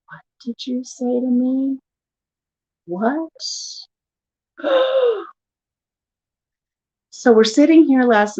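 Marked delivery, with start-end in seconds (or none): 0.1-3.3 mock stunned disbelief; softly played jaw-dropped question; retold shock. Wife reacting to husband's cross remark, stunned. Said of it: "my mouth dropped". 4.6-5.2 exaggerated sharp gasp; shocked gasp. Wife acting out her shock at husband, shocked gasp.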